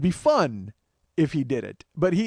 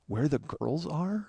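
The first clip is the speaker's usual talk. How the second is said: slow, soft questioning rise on "are"; teasing echo; 3 semitones lower, 7 dB quieter, much slower than usual. Retold or imitated. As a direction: mimic his botched line to needle him. Teasing echo of friend's botched line.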